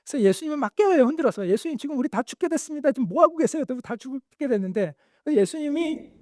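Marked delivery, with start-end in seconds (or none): 1.5-3.6 high, urgent plea to a sleeping master; panicked disciples. Acted. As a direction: wake him in panic, pitched high. Disciples wake Jesus in storm. (겁에 질려 높은 목소리로 다급하게)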